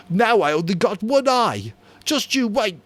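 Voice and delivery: high, loud mocking whine; mimicked whine. A narrator's reading. Jack mocking Piggy's whine for the hunters. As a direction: parody Piggy's threat. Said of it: "Jack mimicked the whine".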